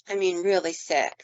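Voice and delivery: normal talking voice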